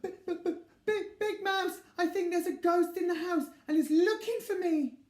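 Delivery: high stuttering frightened voice; stammering scared mouse. Acted. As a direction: stammer out the ghost fear. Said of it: "he cried".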